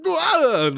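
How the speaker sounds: sudden high mock death yelp; Krillin's death cry. Retelling Krillin's death to a co-host, a loud high mock death cry. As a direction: blurt a cartoon dying cry, loud and high. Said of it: "see Krillin go, like".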